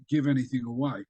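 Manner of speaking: plain reading voice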